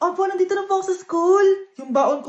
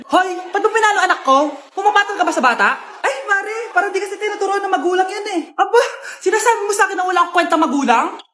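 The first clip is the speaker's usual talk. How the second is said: loud, mocking trade of insults; loud insulting quarrel; 2 semitones higher, 4 dB louder than usual. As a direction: mothers screaming at each other. Two mothers trade insults. (nag-aaway na mga nanay)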